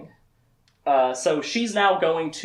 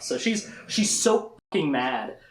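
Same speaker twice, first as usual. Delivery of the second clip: ordinary delivery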